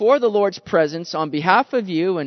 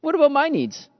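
self-pitying complaint; 5 semitones higher, 5 dB louder than usual. Retold or imitated. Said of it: "may have begun to thought at this point".

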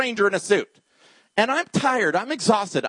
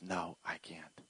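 whispered, choked-up reply; broken whisper; tearful whisper; 12 semitones lower, 21 dB quieter, slower than usual. Retold or imitated. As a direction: breathe it out through tears. Crying man whispers.

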